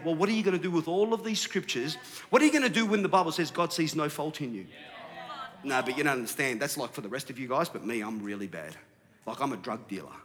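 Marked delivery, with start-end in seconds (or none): 5.6-10.1 low, hushed self-doubting admission; acting out a downcast objector; mock confession. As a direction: confess it quietly, sinking lower and softer. Voices a self-doubting listener's confession.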